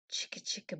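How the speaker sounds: hushed whispered chant. A narrator's reading. Reader to preschoolers, whispering. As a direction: whisper it under the full moon.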